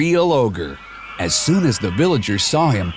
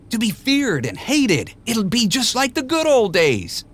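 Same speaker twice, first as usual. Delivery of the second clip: high, wheedling sales pitch; higher-voiced Rumpel reeling him in; tempting Rumpel; 11 semitones higher than usual. A narrator's reading. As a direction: dangle the offer sweetly. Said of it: "he said".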